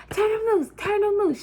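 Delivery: high-pitched grandma pleading to stop the fight; country grandma. Retold or imitated. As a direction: mimic grandma breaking up a brawl. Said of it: "She's so country".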